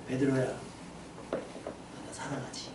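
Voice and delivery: very soft, low and slow; tender, hushed question. Acted. Risen Jesus asking Peter about love, tender and hushed. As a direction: ask it gently, almost under the breath.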